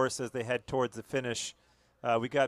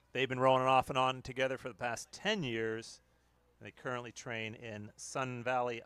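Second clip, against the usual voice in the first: ordinary delivery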